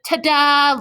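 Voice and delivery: high loud showy ta-da; ta-da announcement. Acted. Lucille pops out of the closet to show her nightie, showy. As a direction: present yourself like a star.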